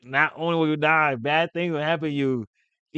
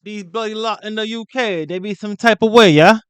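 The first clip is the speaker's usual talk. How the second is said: loud, higher London slang voice, joking; mock British accent; 5 semitones higher, 7 dB louder than usual. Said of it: "I can't do me a good U".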